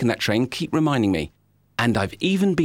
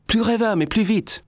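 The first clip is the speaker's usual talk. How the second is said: higher, quoting a fussy director's note; mock French director; 10 semitones higher than usual. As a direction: mimic the director pushing for more. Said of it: "I had to learn a whole new vocabulary".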